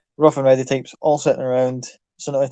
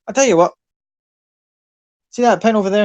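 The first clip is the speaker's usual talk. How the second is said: put-on loud, higher Irish accent; booming Irish ringmaster; 7 semitones higher, 5 dB louder, faster than usual. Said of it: "Your Irish accent is fantastic".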